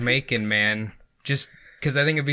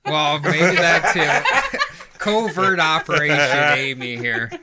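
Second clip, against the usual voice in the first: laughing teasing; 4 semitones higher, 5 dB louder than usual. Real, not acted. Teasing Amy as witch.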